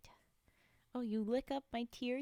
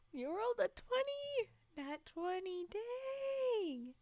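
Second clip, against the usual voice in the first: excited; 9 semitones higher, slower than usual. Real, not acted. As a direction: cheer the lucky roll. Chatter rolled a natural twenty.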